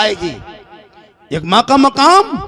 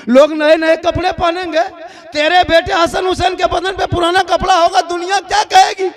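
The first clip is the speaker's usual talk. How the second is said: put-on children pleading to mother; 6 semitones higher than usual. A narrator's reading. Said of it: "फिर माँ के पास".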